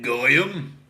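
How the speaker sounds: usual speaking voice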